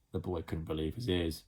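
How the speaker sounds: ordinary delivery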